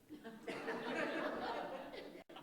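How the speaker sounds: quiet, high unvoiced laugh; a breathless little laugh; soft real laughter. Real, not acted. Laughing after what happened.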